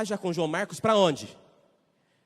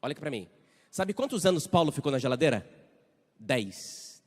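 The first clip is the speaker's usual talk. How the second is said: ordinary talk, nothing marked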